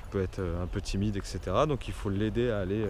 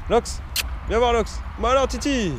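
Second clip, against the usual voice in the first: calling a dog; 12 semitones higher than usual. Real, not acted. Calling dogs over.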